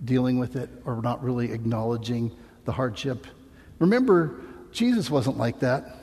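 ordinary talk, nothing marked

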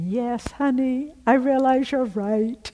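imagined agreeing friend. Pretend.

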